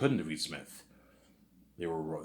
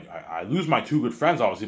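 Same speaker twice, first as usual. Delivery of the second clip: normal talking voice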